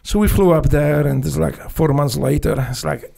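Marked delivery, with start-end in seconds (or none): none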